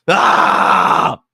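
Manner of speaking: loud, raw outburst of screaming; frustrated scream. Real, not acted. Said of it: "from all the screaming".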